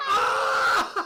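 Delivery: delighted high laughter; laughing. Real, not acted. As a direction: squeal and laugh. Another rare pull.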